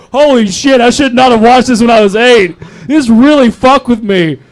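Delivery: loud, high-pitched mock outrage; retold shock. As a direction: blurt it out loud, dragging the last line. Retold shocked reaction to the movie.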